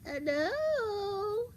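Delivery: high, loud put-on granny; drawn-out falsetto granny hello; disguised wolf as Grandma. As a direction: call out in a fake old-lady voice, high. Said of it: "she heard someone answer".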